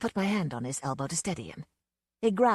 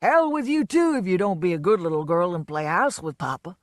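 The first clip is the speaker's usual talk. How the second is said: put-on drunken shout; 7 dB louder than usual. A narrator's reading. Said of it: "he shouted".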